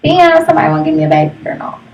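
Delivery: ordinary delivery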